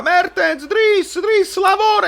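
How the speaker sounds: high-pitched coach yell at a player; imitating Gattuso yelling. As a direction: bark it like a furious coach. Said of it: "le urla".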